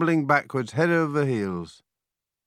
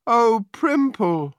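slow, high lament, louder; tearful wail; 9 semitones higher, 5 dB louder, much slower than usual. A narrator's reading. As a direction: draw the name out in a high wail. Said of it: "wept Gemma".

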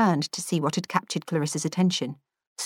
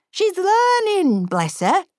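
put-on frail old lady; 13 semitones higher, 3 dB louder, slower than usual. A narrator's reading. Frail sister voiced.

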